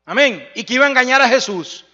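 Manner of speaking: loud, climbing sermon build to a shout; fiery Spanish revival preaching; full-force preaching. Acted.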